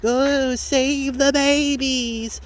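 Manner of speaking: high, slow sing-song mock of a pro-lifer. Ranter mocking pro-lifers' slogan, high slow sing-song. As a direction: sneer it like a pious do-gooder, drawn out. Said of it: "who, like".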